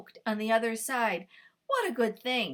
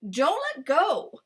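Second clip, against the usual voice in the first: slow, louder, higher warning; 6 semitones higher, 7 dB louder than usual. Acted. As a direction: lean on each word as a caution. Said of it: "warned Cuy".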